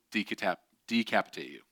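tripping over "decapitate", breaking off and restarting; false start on "decapitate", then corrected; stumbled word. Real, not acted. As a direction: fumble "decapitate" at the first try. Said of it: "I don't know how I messed that up".

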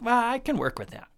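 high-pitched, easygoing acceptance; high, offhand willingness to make do; God. Acted. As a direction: shrug it off in a high voice. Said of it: "is how God's like".

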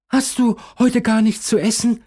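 put-on high, curious child voice. A narrator's reading. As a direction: ask like a little girl. Child asks Caroline.

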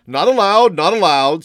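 high, loud, sing-out refusal; brisk old merchant refusing outright; insistent old shopkeeper. A narrator's reading. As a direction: wave him off loudly in a high voice.

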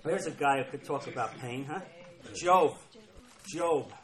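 usual speaking voice

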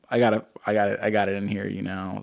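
normal talking voice

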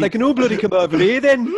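put-on showing how a heavy Brummie accent should sound. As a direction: ham up a Brummie voice. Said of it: "heavy West Midlands Brummie for me".

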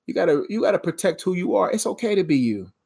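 normal talking voice

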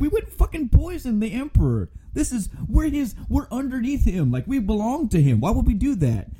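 high-pitched indignant council member; protesting judges. Retold or imitated. Retelling the judges' outraged denial in a high voice. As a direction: squeak out the protest like a panicked official.

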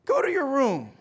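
scolding dad. Acted. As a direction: send your boy to his room. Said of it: "his dad stands up, says".

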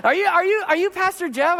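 a fan finally placing the pastor; sudden recognition. Retold or imitated. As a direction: catch yourself mid-thought, amazed.

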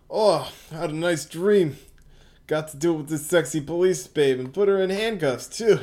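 a hint of a leering criminal; lightly voiced sleazy guy. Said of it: "I'll do this guy".

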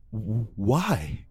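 scared stutter; stammering fear. Acted. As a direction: stumble over the why.

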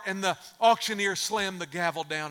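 ordinary talk, nothing marked

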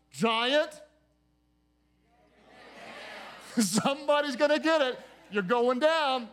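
rousing pulpit preaching, pushing each question; preaching at full force. Acted. Pastor preaching to his congregation about addictions, rousing sermon voice.

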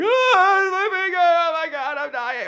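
high, loud anguished cry; hammed-up pained howl; mock kid wailing. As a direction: wail like a hurt kid. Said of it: "And I'm just like".

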